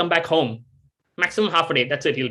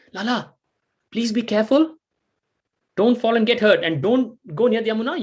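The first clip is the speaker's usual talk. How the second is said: higher anxious warning; worried mother; 6 semitones higher than usual. Acted. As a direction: fret over the child's safety.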